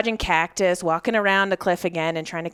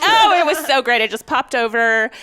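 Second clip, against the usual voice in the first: higher and louder, playful fake boast; mock breezy brag; 5 semitones higher, 8 dB louder than usual. Mocking the easy shot she wishes she'd had, as a high breezy brag. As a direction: ham up an easy-hunt brag. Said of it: "Or like".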